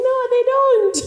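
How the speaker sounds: high and loud mock wail; mock lament. Agreeing with her friend's joke, high loud mock wail. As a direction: answer with exaggerated despair. She answers joke, exaggerated.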